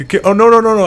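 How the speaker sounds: high, loud alarmed no-no-no; mock-panicked dad yelling.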